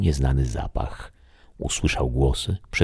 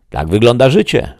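much higher, louder declaration; the voice declaring; 9 semitones higher, 9 dB louder than usual. Acted. The voice tells the shadow about life, raised and louder. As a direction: proclaim it plainly. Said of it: "odezwał się głos".

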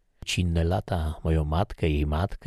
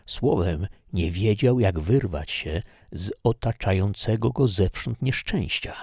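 usual speaking voice

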